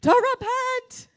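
high, drawn-out preacher's yell; sudden loud, high cry of warning. Shown as a demonstration. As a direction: yell it slowly at the congregation. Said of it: "yelling at you".

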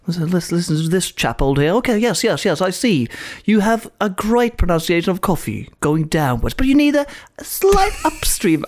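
mock RP lecturer voice; imitating British lecturer. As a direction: mimic the teacher hopping between booths. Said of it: "han satt och lyssnade".

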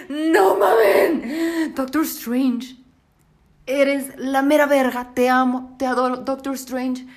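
slow, loud, tearful cry of adoration; overwhelmed fangirl. Real, not acted. Fan crying out her love for Doctor Strange. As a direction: wail out how much you love him.

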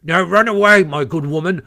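loud, curt order; very short. A narrator's reading. Sergeant orders the washerwoman off, short. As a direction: dismiss her briskly. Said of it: "he said to me very short".

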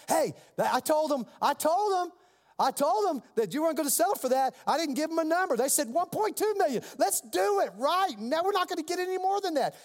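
imitating a worked-up attorney, pitched high; high, insistent retelling of a frantic plea; urgent pleading. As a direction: press them hard to take the deal. Retold attorney urges taking the deal.

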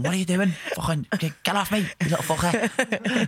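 angry yell at the dog. Retold or imitated. Girlfriend yelling at the dog in the background, mock angry. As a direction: shout at the dog off its arm. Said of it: "I can hear Laura going".

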